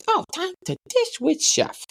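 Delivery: high, slow lisping cartoon voice; Tiny Chef. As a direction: announce it as a lisping little puppet. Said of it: "and he goes".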